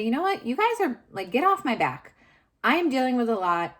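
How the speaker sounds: high, loud, fed-up retort at nagging family; exasperated outburst. Retold or imitated. To her in-laws urging her to get help, exasperated and loud. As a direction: throw it back at them, fed up.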